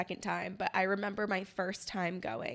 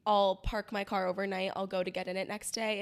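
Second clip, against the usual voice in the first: ordinary delivery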